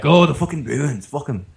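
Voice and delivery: joking imitation of an Irish accent. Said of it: "they were all Irish, of course".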